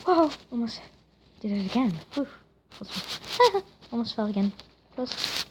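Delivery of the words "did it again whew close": normal talking voice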